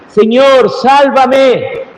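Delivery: high, pleading cry for rescue; crying out to the Lord. Retold or imitated. Peter sinking cries to the Lord, high plea. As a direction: call out like a man sinking. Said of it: "Y es cuando grita".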